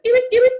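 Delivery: high, loud eep eep; squeaky alarm noise. Retold or imitated.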